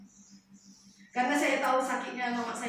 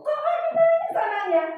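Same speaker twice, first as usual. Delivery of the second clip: puzzled surprise; 13 semitones higher, 4 dB louder than usual. Retold or imitated. Puzzled at child crying harder.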